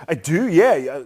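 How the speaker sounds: normal talking voice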